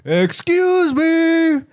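high, slow, drawn-out public call-out. Retold or imitated. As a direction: call him out for the whole store. Vigilante confronting predator in store.

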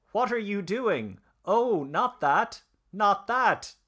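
high, slow desperate begging; pleading. Retold or imitated. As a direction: beg for your life, voice rising. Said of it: "he pleaded".

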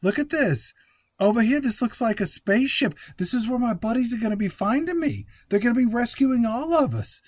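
high-pitched, excitable bro impression; mock movie-star bro voice; Mark Wahlberg. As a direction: play an amazed, dim bro. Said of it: "Mark Wahlberg comes up and he's like".

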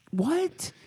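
high-pitched incredulous "what"; acted disbelief. Dad hearing of a secret daughter, acted disbelief. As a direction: squeak it in mock shock.

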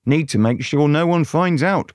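ordinary delivery, nothing marked

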